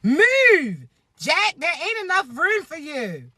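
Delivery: yelling at Jack to get off; mock scream. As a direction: shove him off with a mock shout. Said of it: "I would have been screaming".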